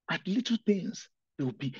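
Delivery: normal talking voice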